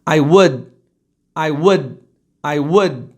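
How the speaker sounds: low "would" falling to a held d; half-made d. Shown as a demonstration. Teacher demonstrating, "would" with unreleased d. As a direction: abandon the d of "would" midway. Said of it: "decidisse não fazer isso no meio do caminho".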